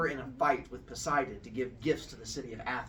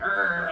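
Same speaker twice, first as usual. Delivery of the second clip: higher, louder mock growl; stink-eye growl; 4 semitones higher, 6 dB louder than usual. Storyteller mimics weavers glaring at each other, stink-eye growl. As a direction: growl at a rival across the loom. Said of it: "they're getting, like, the stink".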